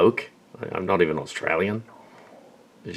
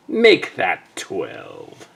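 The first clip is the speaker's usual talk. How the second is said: slow deadpan punchline; much slower than usual. Retold or imitated. Dream retelling, punchline after firing.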